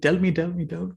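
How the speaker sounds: high, eager tell me, tell me; an impatient villager begging to hear; eager pleading. Retold or imitated. Villager begs Ajahn Chah for his fortune, eager. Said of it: "he's very enthusiastic".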